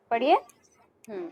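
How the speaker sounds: usual speaking voice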